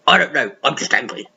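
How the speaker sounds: low, sulky grumble; grumpy Angry. Acted. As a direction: grumble it like a sulking little creature. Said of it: "And Angry said".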